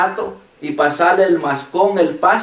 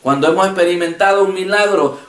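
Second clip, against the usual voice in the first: ordinary talk, nothing marked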